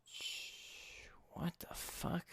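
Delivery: whispered curse under the breath; frustrated whisper. Real, not acted. Streamer whispering to himself when game audio fails. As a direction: hiss it to yourself, annoyed. Streamer confused over settings.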